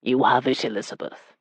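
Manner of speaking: low murmur, dropping away softly; low consent. Acted. Husband gives wife permission, low and quiet. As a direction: grant it in a deep, quiet voice.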